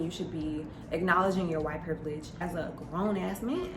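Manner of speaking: normal talking voice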